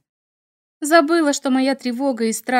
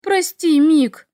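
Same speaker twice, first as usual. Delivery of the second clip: higher, louder rushed sorry; alarmed apology; 7 semitones higher, 6 dB louder, much slower than usual. A narrator's reading. Heroine apologizing to the struck dragon, alarmed. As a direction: blurt out a guilty apology. Apologizes after striking him.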